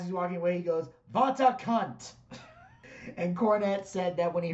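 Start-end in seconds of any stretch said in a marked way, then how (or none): 1.1-2.1 put-on Iron Sheik accent. The Iron Sheik insulting the lady while walking away in his Iranian accent. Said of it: "But in his accent".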